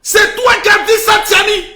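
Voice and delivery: high, loud accusation; accusing shout. Acted. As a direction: yell the accusation at him. Accusing Tiani loudly. (accusation criée)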